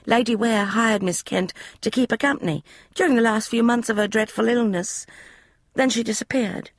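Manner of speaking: put-on high, eager young maid's voice; a chatty servant girl, pitched high; maid Polly. Maid Polly explaining the room to Emma, high eager voice. Polly tells of Miss Kent.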